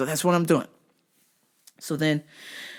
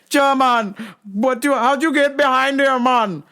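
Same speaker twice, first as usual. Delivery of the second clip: high, loud Jamaican patois voice; mock Jamaican accent; 7 semitones higher, 3 dB louder than usual. Dreadlocked guard challenging him in a Jamaican accent.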